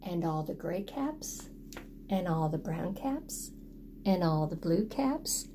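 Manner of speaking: hushed list of falling caps; soft listing. A narrator's reading. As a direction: count them off gently. List read softly.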